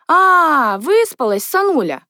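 merry, starting high and loud; cheerful greeting. A narrator's reading. Mother greets Natasha as she wakes, cheerfully. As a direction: greet the sleepyhead merrily. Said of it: "спросила она весело".

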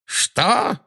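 high, startled exclamation; sharp rise of disbelief; furious exclamation. A narrator's reading. Villain wildly looking around, shocked exclamation. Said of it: "воскликнул Волан-де-Морт, бешено озираясь".

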